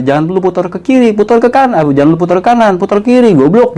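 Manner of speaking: high, impatient back-and-forth orders; exasperated brother. Retold or imitated. Brother over phone correcting hand spinning, exasperated. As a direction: bark corrections at a clumsy student.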